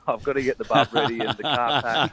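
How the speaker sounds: laughing while talking; chuckling through the words. Real, not acted. Laughing about family outing plans.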